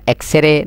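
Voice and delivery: এক্সরে with an added vowel, "ek-se-re"; mistaken X-RAY. Shown as a demonstration. Teacher warning students, এক্সরে as "ek-se-re". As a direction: say এক্সরে in three syllables, "ek-se-re". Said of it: "ন হইবেন".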